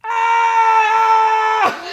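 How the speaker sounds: high mock yell; hammed-up falling cry; falling scream. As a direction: yelp high as if tumbling down a well. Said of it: "and then he goes".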